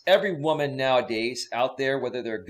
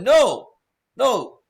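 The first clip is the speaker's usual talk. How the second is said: loud, high exasperated scolding; 10 semitones higher, 5 dB louder, slower than usual. Acted. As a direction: shout down a fool, fed up. Scolding an unqualified job applicant.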